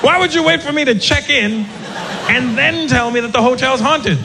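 high-pitched frightened whine; high panicked. Retold or imitated. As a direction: squeal it like you're scared. Panicked reply to bellman.

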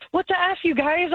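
high-pitched mock outrage at doctors; retold freak-out. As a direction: replay a panicked challenge, pitched high. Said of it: "I'm freaking out".

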